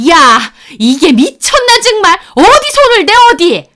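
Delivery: high-pitched, loud outburst; trembling rage. Acted. Mother-in-law berates her for grabbing sister-in-law, enraged. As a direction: screech in outrage at the offender. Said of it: "온몸을 부들거리면서 저한테 화를 내시는".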